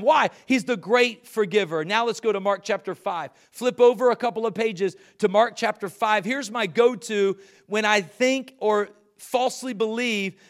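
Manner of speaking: rising pulpit fervor; preaching at full force. Acted. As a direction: preach it out to the whole room.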